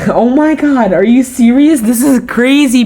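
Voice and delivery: high, loud, excited disbelief; thrilled. Retold or imitated. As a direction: burst out in fan excitement. Excited reply to friend.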